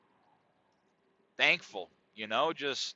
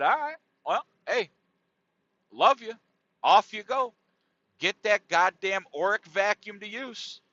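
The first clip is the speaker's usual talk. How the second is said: mock sweet sign-off; 7 semitones higher than usual. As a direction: brush her off in a sing-song. Sarcastic sign-off to mom.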